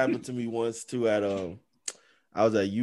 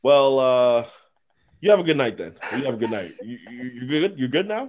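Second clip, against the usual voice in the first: flustered awkward cops; 6 dB louder than usual. Retold or imitated. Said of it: "They're like".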